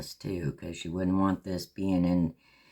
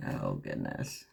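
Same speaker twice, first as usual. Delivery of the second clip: breathy whisper; 11 semitones lower, 7 dB quieter than usual. Real, not acted. Sighs after her mistake.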